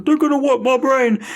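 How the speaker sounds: high, fussy robot voice; mock C-3PO. Imitating C-3PO, fussy robot voice. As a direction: fret like a nervous droid. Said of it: "C three P O is just like".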